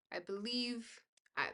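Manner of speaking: ordinary talk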